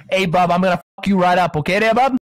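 mocking tough guy. Mocking chat as a loud tough Mainer. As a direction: ham up a threatening local. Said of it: "They're fucking telling me".

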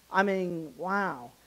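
ordinary delivery

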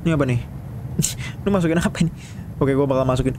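normal talking voice

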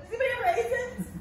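squeaky high question; high mock ask. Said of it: "I'm gonna be like".

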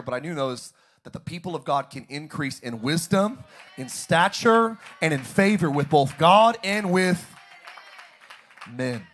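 loud, driving repeated preaching lines; full-force preaching. Acted. Pastor preaching to the church, loud and driving. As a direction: hammer each line home to the congregation.

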